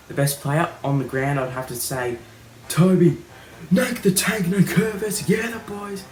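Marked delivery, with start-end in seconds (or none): 2.7-6.0 excited hype. Real, not acted. Hyping best player name.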